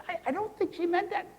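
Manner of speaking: high, gentle peacemaking voice; smooth it over sweetly; soothing mediator. Retold or imitated. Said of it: "So then I call the other one".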